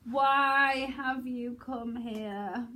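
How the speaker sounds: long, stretched-out plea; pleading. Shown as a demonstration. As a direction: draw every word out, imploring. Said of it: "almost like pleading".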